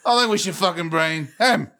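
high take, HIM split off and punched at the end; stress on HIM. Shown as a demonstration. Comic finishing the word-by-word run, stress on HIM. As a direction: save the force for a final "him". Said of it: "emphasize each word".

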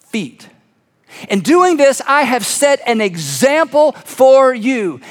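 slow, forceful, measured preaching; solemn proclamation. Acted. Jesus to the disciples after washing their feet, proclaiming. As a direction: stretch the key words with authority.

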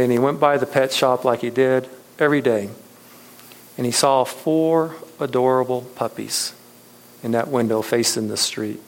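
normal talking voice

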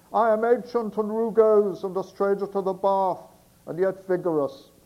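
put-on loud, bellowing old sailor; Barnacle Bill voice. Sailor outside the door bellows to the girl, loud sailor shout. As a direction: shout it up from the street like a rough seaman. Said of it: "the Barnacle Bill voice for Barnacle Bill".

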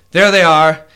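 loud, stern father pointing out the culprits; father's accusing voice. Acted. Father to the guards, loud and accusing. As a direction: denounce them as the king's father figure. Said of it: "He says".

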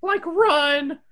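high, loud panicked shout; Shaggy shouting. Acted. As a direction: yell it like fleeing Shaggy. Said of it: "Shaggy shouts to Scooby".

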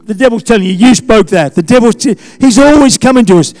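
full-force sermon delivery against the devil; fiery preaching. Acted. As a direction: preach it hard, rallying the church.

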